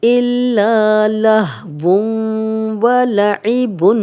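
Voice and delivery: slow chanted tajweed; Quranic recitation. Shown as a demonstration. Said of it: "اس طرح پڑھیں گے".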